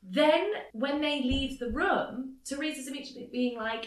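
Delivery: ordinary talk, nothing marked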